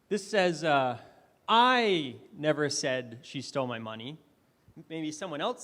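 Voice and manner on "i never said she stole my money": I punched, rising at the end; stress on I. Shown as a demonstration. Speaker demoing stress to the audience, stress on I. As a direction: hit the word I hard. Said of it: "I emphasized different words".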